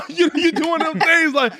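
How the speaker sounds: squealing laugh into words; high laugh. Real, not acted. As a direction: laugh high then talk. Laughing at himself.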